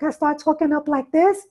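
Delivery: high-pitched agitated demo voice; talking up high, rising to the end; voice up an octave. Shown as a demonstration. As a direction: jump way up high, as if getting upset. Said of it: "my voice go up an octave".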